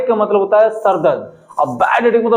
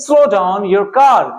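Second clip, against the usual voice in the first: each word laid out slowly and clearly; slow model sentence; much slower than usual. Shown as a demonstration. Teacher slowly models a phrase for students. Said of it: "कैसे बोलेंगे?".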